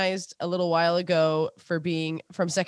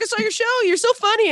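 high-pitched imitated fan praise; gushing fan; 11 semitones higher, 4 dB louder than usual. Fan greets comedian in story.

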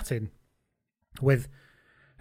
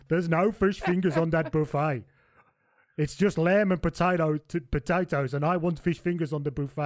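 put-on Johan's South African accent; 3 semitones higher than usual. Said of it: "let's get the accent right".

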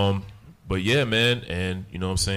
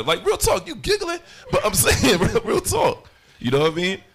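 much higher, louder, half-sung insistence; playful shout; 7 dB louder than usual. Real, not acted. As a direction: yell it laughing, half singing. Rapper playfully insists over laughter.

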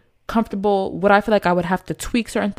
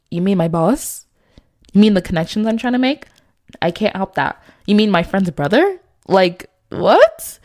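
acted indignant questions, rising sharply at the ends; mock-baffled comeback, punching the last word; incredulous retort; 5 dB louder than usual. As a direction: throw each question back in disbelief. Acting out her retort to a jealous man.

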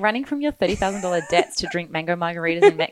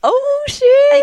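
shocked; 8 dB louder than usual. Real, not acted. Shocked by the PI's theory.

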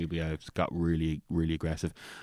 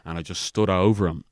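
ordinary talk

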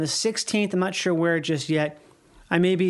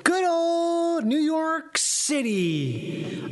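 drawn-out sing-song; 9 semitones higher, much slower than usual. Pretend. Drawn-out high sing-song announcement.

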